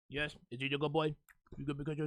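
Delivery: soft, low cooing praise; dog praise. Real, not acted. Host praising his dog Chester on air, soft and low. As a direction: coo to the dog softly.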